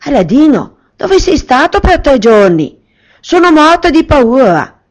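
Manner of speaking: mother greeting her lost son, anxious and high; worried mother. Acted. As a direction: scold him out of sheer fear. Mother voiced in story.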